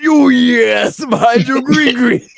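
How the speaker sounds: loud, high, slow hammed-up wrestler boast; mock Macho Man shout. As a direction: drag out the green like a ring promo. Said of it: "He said".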